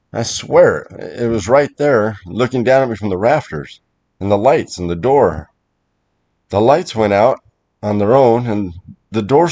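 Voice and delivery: urgent, emphatic account of a scare; agitated insisting. Acted. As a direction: plead to be believed. Insists to doubting wife.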